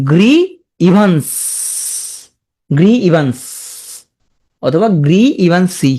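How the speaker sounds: "গ্রি ইভান্স" as two separate words; memory-aid pronunciation. Shown as a demonstration. Teacher giving students a way to remember, split in two. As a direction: stretch "গ্রি", then a distinct "ইভান্স". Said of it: "মনে রাখতে পারো".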